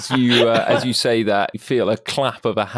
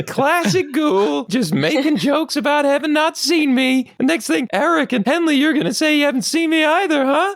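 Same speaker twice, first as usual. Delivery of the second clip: high pig voice, quickening as it goes; jokey little pig. Acted. Pig thinks friends are joking, teasing character voice. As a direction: tease old pals as the little pig.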